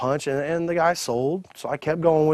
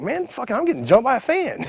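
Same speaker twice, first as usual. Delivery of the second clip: high-pitched retold disbelief; mock alarm; 10 semitones higher than usual. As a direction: exclaim as if ambushed.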